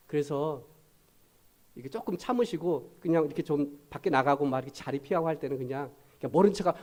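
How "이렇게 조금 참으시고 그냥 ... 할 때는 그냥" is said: ordinary talk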